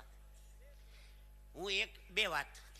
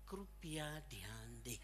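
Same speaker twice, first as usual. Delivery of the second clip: soft, low, sugary Hindi announcement; sweet air hostess; 7 semitones lower, 9 dB quieter, much slower than usual. Retold or imitated. Mimicking the air hostess's Hindi announcement, softly sweet. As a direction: coo it slowly, sweet as syrup. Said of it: "એવું મીઠું મીઠું બોલો".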